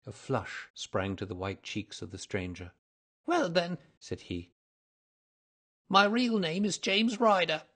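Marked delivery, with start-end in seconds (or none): 3.3-3.8 high, loud nervous concession; reluctant little man. A narrator's reading. 5.9-7.6 high, loud little man's voice; nervous confession. A narrator's reading. Little man confesses real name.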